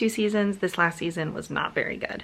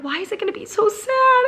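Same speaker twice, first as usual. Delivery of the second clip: mock anguished wail; 13 semitones higher, 4 dB louder than usual. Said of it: "I'm like".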